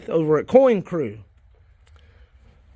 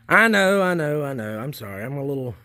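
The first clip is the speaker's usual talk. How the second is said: ordinary delivery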